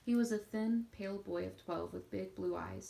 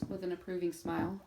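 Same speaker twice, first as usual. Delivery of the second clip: usual speaking voice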